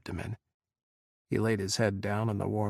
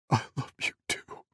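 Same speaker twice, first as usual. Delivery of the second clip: whispered confession; 3 dB quieter than usual. Acted. Adam's confession, voice breaking.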